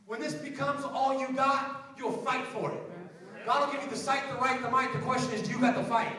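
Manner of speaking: loud, building call to fight for your dream; preaching at full force. Acted. Sermon climax, rising preaching.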